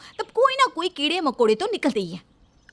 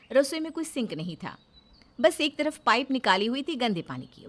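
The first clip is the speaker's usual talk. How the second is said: calm storyteller reading a Hindi serial; story narration; 5 dB quieter than usual. A narrator's reading. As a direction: narrate the scene evenly, setting it up.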